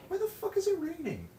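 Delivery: very high squealed disbelief; exasperated squeal. Real, not acted. Streamer notices rain mid-bake, exasperated squeal.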